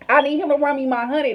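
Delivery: ordinary talk, nothing marked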